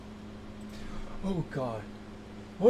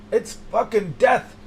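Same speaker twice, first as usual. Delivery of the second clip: normal talking voice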